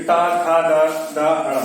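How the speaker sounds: टा खा गा गा अड़ा sounded one by one with the tongue curled up; retroflex sounds demo. Shown as a demonstration. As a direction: tick off टा, खा, गा, गा, अड़ा as a model. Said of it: "टा वर्ग देखिए".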